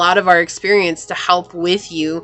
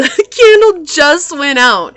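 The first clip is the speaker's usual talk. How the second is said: laughing; 6 semitones higher, 5 dB louder, slower than usual. Real, not acted. Laughing joke about candle out.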